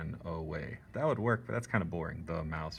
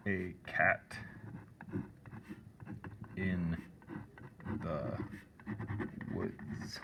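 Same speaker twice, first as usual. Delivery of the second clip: each syllable set apart by long pauses; one syllable at a time; 7 dB quieter, much slower than usual. Shown as a demonstration. Teacher counting haiku syllables, one word at a time. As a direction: count out every syllable slowly. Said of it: "One syllable, two syllable".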